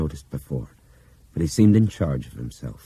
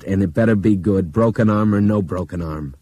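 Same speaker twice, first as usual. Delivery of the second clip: louder, hard stress on better; stern warning; 4 semitones higher, 8 dB louder than usual. Acted. Pilot demanding answers from Nick, stern warning. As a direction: threaten it through set jaw. Pilot warns injured copilot.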